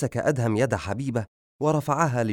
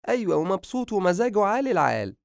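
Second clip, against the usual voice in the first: high, gleeful motherly voice; pleased mother, pitched well up; delighted mother; 10 semitones higher than usual. A narrator's reading. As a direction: share good news, voice lifted high. Mother happily reports son's good mood.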